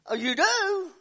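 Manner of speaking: high, loud mock surprise; surprised. Preacher imitating the man's startled reply, high and loud. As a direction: act out his startled reply.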